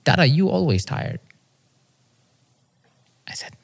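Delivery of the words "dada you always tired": put-on higher, small-child voice with a falling end; hint of a child's voice; lightly imitated toddler son. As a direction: say it simply, like a little kid. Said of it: "because he said".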